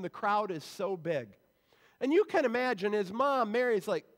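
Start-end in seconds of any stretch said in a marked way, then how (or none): none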